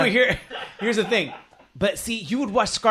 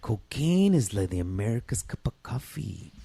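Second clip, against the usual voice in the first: lower, softer put-on villain voice; a hint of a low movie villain; movie character voice; 7 semitones lower, 7 dB quieter than usual. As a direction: drop low and quiet like the character. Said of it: "You would hear guys like that".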